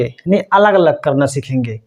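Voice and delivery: normal talking voice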